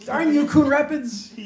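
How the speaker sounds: high, slow, drawn-out mock outrage; hammed-up high-pitched lament; mock cursing. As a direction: curse the villains melodramatically. Mock curse at imagined card thieves.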